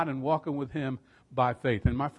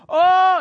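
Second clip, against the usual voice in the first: awed exclamation; 13 dB louder than usual. Real, not acted. Preacher's own awe.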